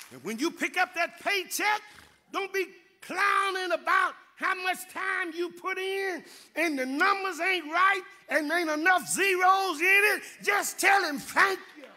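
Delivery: loud, high, fervent preaching shout; preaching at full force. Acted. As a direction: drive it home to the congregation. Preacher exhorts the congregation.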